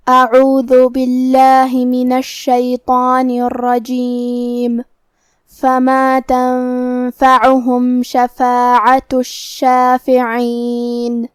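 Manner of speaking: slow, drawn-out tajweed chanting; Quranic recitation. Shown as a demonstration. Teacher reciting the verse for students, slow melodic chant. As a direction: intone each verse slowly and melodically.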